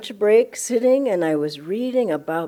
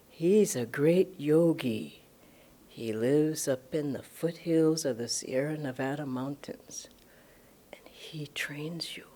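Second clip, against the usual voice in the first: hushed; 6 semitones lower, 11 dB quieter than usual. Retold or imitated. Someone telling her of a great yogi, in a hushed whisper. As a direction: confide it like a secret.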